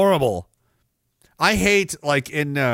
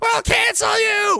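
high, slow hammed-up yell of the offended crowd; mock indignant shout, much higher and drawn out; mock outraged shout; slower than usual. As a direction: bellow it like a cartoon mob, slow and shrill. Mocking angry critics' threat.

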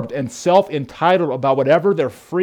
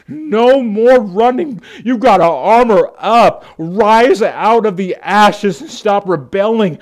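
stern exhortation; 5 semitones higher, 5 dB louder than usual. Retold or imitated. Church friends confronting a rebel, stern and loud. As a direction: demand he clean up his life. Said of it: "they said".

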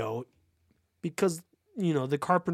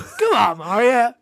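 loud, higher New York call; New York accent; 7 semitones higher, 8 dB louder, slower than usual. Acted. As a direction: call out like a Brooklyn guy.

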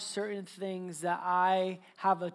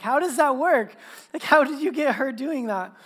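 higher and louder, amused disbelief; baffled dad; 5 semitones higher, 9 dB louder than usual. Retold or imitated. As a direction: ask like you can't make sense of it. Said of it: "I'm like".

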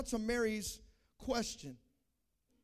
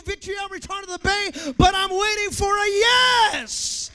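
God's voice building to a shout; 8 semitones higher, 12 dB louder than usual. Acted. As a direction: voice God calling, rising to a roar. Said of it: "And God says".

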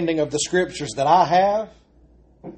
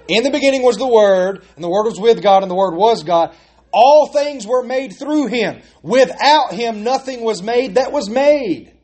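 solemn scripture reading; 5 semitones higher than usual. Acted. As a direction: proclaim each line with weight. Scripture read aloud in sermon.

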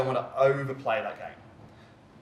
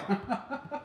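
embarrassed laugh; 10 semitones higher, 4 dB quieter than usual. Real, not acted. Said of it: "Trying to talk and drink at the same time".

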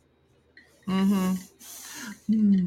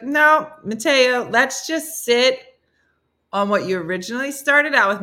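insistent; 6 semitones higher, 6 dB louder than usual. Acted. Date pulls Mateo back to his accent remark, insistent. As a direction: stretch it out, refusing to be sidetracked. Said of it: "You're like".

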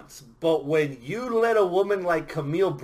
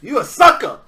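loud, high taunt; 6 semitones higher, 10 dB louder than usual. Real, not acted. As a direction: jab him with a mocking call-out. Taunting co-host.

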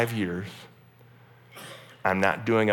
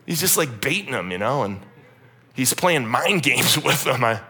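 ordinary talk

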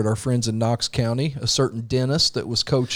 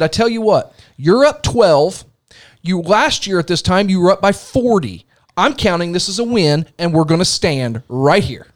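raised, emphatic stand-your-ground speech; retold defiant retort; 4 semitones higher, 4 dB louder than usual. Coach refusing rival's challenge at girls' game, defiant. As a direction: lay it down firmly, point by point.

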